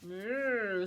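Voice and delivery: loud lowing moo; cow moo. Acted. Storyteller mooing as the cow for children. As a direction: moo it out long. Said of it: "said the cow".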